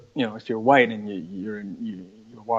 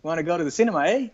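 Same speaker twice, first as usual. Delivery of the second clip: mock Canadian accent; 7 semitones higher, faster than usual. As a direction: tease the Canadian "eh", lightly put-on. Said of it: "Canadians say a lot of "eh".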